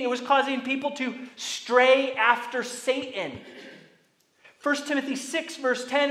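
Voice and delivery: preaching at full force. Acted. Preacher warns the church about false teaching, fervent and loud. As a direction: hammer the references like a revival preacher.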